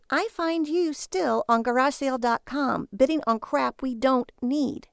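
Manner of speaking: slow, sneering wife voice; scornful wife. Acted. Wife scolds Barry at night, slow and sneering. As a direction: drag it out in disdain.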